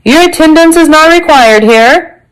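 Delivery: high-pitched official dismissal; dismissive inspector. Acted.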